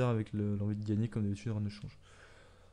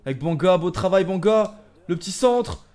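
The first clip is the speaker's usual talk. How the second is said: excited shouting; 6 semitones higher, 10 dB louder than usual. Real, not acted. Attack building in the match.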